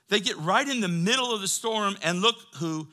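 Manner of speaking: loud, high-pitched preacher; forceful preaching. Real, not acted. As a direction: drive it home to the congregation. Preacher raises his voice.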